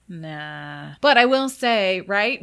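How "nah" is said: low, soft, drawn-out refusal; mock dismissal. Retelling the spirits' answer to her questions, mock dismissal. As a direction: brush it off quietly and low.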